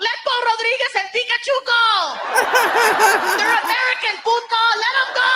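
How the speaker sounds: very high, shouted mock chant from cars; high-pitched yelling crowd voice; passers-by yelling. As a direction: holler it from a passing car.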